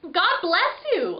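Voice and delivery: high, slow little voice calling from the dark. Acted. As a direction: call it out from a hidden corner. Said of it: "a little voice called out".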